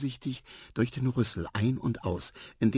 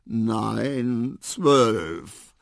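drawn-out, louder words; drowsy correction; 2 semitones higher, 7 dB louder than usual. A narrator's reading. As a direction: stretch each word out sleepily. Sleepy elephant corrects the mouse. (gedehnt berichtigen)

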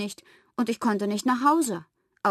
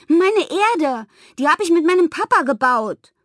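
put-on high, loud child's wail of protest; upset outcry; 8 semitones higher, 8 dB louder than usual. A narrator's reading. Pippa mourning the globe built with Papa, loudly upset. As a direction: cry out over your broken treasure.

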